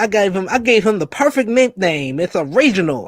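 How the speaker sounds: a hint of Dusty bragging; mock Dusty boast; imitating Dusty. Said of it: "He said".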